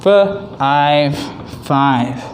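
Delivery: "f-, I've, five" split into onset and rime, then blended; sounding out. Shown as a demonstration. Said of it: "the last one is".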